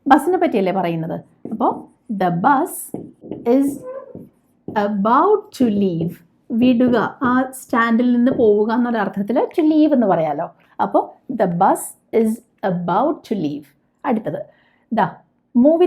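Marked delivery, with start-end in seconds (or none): 2.1-6.1 drawn-out, word by word; slow model sentence. Shown as a demonstration. Teacher showing learners the English sentence, very slow. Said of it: "teacher models sentence". 11.3-13.6 drawn-out, word by word; slow model sentence. Shown as a demonstration. Teacher showing learners the English sentence, very slow. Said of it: "teacher models sentence".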